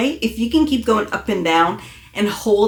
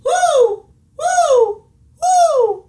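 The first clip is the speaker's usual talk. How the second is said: high, louder relaxed owl hoots; 5 dB louder, much slower than usual. Shown as a demonstration. Coach shows the relaxed correct hoo. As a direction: let the hoo float up high. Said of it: "Be relaxed".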